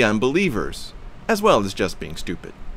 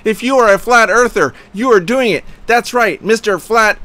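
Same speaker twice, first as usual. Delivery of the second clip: high, loud incredulous rant; shouted outrage at a flat earth comment; going berserk; 8 semitones higher, 5 dB louder than usual. Retold or imitated. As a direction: read it like you're losing your temper. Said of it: "I was going berserk".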